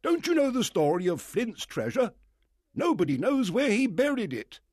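high, loud, eager gentleman; the squire bursting to tell of Flint; excited squire. Acted. Squire voiced in story.

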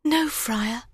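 high woman's voice denying it; high-pitched Hero reply; innocent young bride. A narrator's reading. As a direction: answer in a high, earnest voice. Hero answers the friar.

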